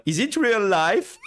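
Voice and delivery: high, drawn-out disbelief; mock dazed wonder. Recalling the ride home, giddy mock disbelief. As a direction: stretch it out in giddy astonishment. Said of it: "j'étais en mode".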